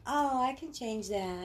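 high, slow dreamy voice; mock your naive younger self, up high and slow; starry-eyed "I can fix him" voice. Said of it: "you think".

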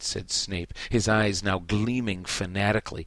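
ordinary talk

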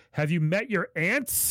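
high, drawn-out mock yell; retold yell. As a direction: act out an exasperated yelled retort. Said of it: "Sam yells".

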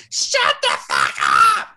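loud, forceful retold yell; hammed-up shout; mock angry shout. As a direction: bellow it as the fed-up girlfriend. Acted quote in retold quarrel.

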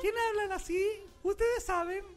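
high put-on questioning voice; mock high voice. Host asking who talks that way, mimicking high.

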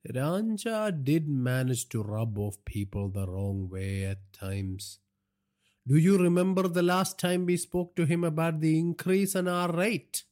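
slow crime boss, rising high into the question; domineering father, reflective. A narrator's reading. Father reminds son of rate dispute, domineering. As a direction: start slow, then climb in pitch as the grievance surfaces. Naresh's voice, recalling the murdered man.

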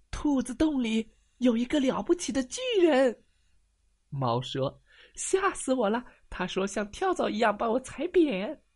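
high, breathless scared cat voice; a shaken kitten pouring it out; squeaky scared cat; frightened cat. Acted. Scared cat tells the bear.

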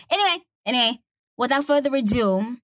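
high, bright-up show-opening announcer voice; podcast host intro. Acted. Host welcoming listeners to her podcast, upbeat announcer. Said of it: "podcast host intro".

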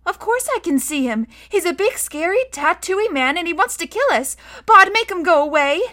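put-on high-pitched, scared child; rising alarm, ending in a loud plea; frightened young girl. A narrator's reading. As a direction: plead in a high little girl's voice.

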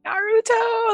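high cartoon-hero cry; Naruto. Fan mimicking the lady who voiced the hero, high cartoon cry. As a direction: call it out high like an anime kid. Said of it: "I remember the lady who voiced Naruto".

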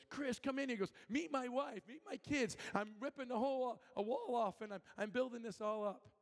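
excited greeting. Retold or imitated. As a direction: show off proudly. Proud owner greeting visitor.

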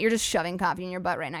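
usual speaking voice

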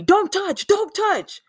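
high, strained imitation of a man barking a warning; mock yelling delivery man. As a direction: imitate a stranger yelling from his car. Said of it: "yelling at me".

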